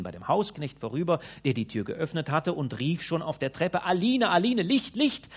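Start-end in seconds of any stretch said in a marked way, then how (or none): 3.8-5.3 high, loud calling up the stairs. A narrator's reading. Peregrinus calling the maid from the stairs, loud call. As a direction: shout for the maid. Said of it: "Und zwar so laut".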